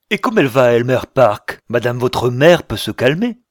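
loud, irritated comeback; angry retort. A narrator's reading. Will answering the captain about his mother, angry. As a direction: cut back at him loudly, with annoyance.

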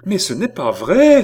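loud, high cry of denial; desperate innocence. A narrator's reading. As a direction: protest your innocence in despair. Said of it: "avec l'accent d'une innocence désespérée". (cri d'innocence désespérée)

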